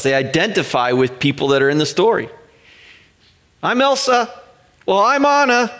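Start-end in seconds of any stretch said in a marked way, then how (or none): 3.6-4.3 put-on high, eager little-girl voice; a light child claiming Elsa; imitating child. Kids voiced claiming movie roles. 4.9-5.7 put-on high, eager little-girl voice; a light child claiming Elsa; imitating child. Kids voiced claiming movie roles.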